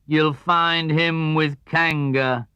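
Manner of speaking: slow, dry donkey drone; gloomy Eeyore. Acted. Eeyore remarking on Tigger to Pooh, slow gloomy drone. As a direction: drag each word out, unimpressed.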